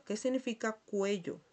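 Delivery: ordinary talk, nothing marked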